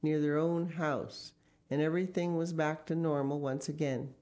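usual speaking voice

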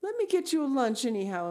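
high, quick pleading; imploring. Acted. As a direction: beg the guest to stay, hurrying. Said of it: "implored Anne".

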